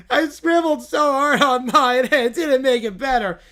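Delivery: hammed-up pouting complaint; mock whiny child. To the judge, a whiny kid complaining about a scribbled drawing.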